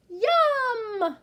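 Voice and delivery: high, loud happy yum; yelled yum. Acted. Yak now loving food, high loud yum. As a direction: shout it with glee. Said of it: "the yak who yells".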